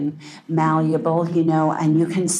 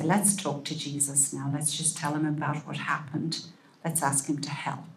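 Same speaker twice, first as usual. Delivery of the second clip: hushed, gentle invitation to pray; soft bedtime prayer; 9 dB quieter than usual. Shown as a demonstration. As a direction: speak it quietly beside the child in bed.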